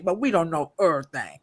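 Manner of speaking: ordinary talk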